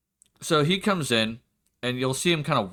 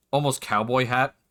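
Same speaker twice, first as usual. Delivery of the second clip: usual speaking voice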